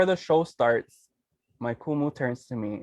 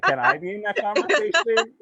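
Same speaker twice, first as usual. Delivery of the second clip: words breaking into laughter, higher; real laughter, pitched up; laughing while talking; 9 semitones higher, 3 dB louder than usual. Real, not acted. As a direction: giggle through the question. Laughing through retold question.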